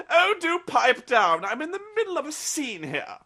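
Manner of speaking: hammed-up haughty camp scold, high and loud; prissy vampire. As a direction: shush everyone like a diva mid-performance.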